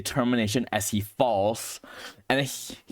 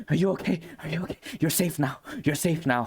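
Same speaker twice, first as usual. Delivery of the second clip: reassuring; 5 semitones higher, faster than usual. Acted. Paula comforts Saf.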